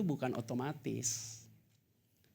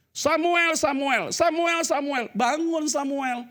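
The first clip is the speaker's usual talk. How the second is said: loud, slow drawn-out call; 12 dB louder, much slower than usual. Acted. God calling the sleeping boy Samuel loudly. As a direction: call his name across the dark.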